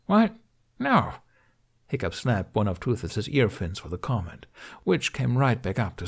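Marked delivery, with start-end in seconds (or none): 0.1-1.1 sharp high yelp of no; startled denial. A narrator's reading. Hiccup denying the dragon's teasing, shocked and high. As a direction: jump in shocked, high pitched.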